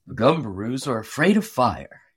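low, deliberate voice of a big thoughtful woodsman; Paul the giant logger. A narrator's reading. Paul thinks aloud about the Gumbaroos, low and musing.

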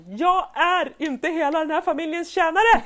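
mother yelling at her family in the car; mock yell. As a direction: yell it at the whole family, fed up. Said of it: "jag skriker så här".

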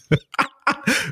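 high-pitched burst of laughter; real, high giggling fit. Real, not acted. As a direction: crack up at the memory. Laughing as he thinks back.

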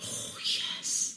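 breathy whispered delight; hushed relish at the food; whispered yes. Real, not acted. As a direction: sigh it out as if tasting it. Reacting to lobster order.

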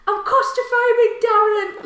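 high, loud, drawn-out holler; shouting across store. Retold or imitated. Celebrity shouted to across the shop, loud re-enacted call. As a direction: re-enact a yell to someone at the far end. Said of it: "shouted across the store".

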